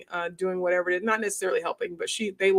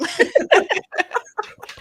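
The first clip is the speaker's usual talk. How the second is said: high laugh; 4 dB louder than usual. Real, not acted. Laugh after mocking herself.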